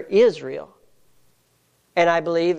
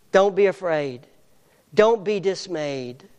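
ordinary talk

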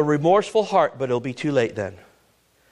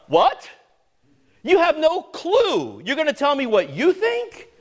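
incredulous retort; 6 semitones higher than usual. Real, not acted. Preacher mocks unbelievers' claim.

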